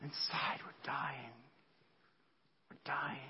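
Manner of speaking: barely-there whisper; hushed confession. Acted. Churchgoers admitting the grief inside, hushed and whispered. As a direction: drop to a whisper for the hidden truth. Hidden truth behind the happy face.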